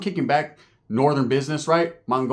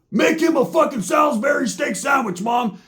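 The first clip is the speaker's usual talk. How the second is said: yelling for mom; 10 semitones higher than usual. Retold or imitated. Roach yelling food orders to his mom. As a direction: shout the order into the house.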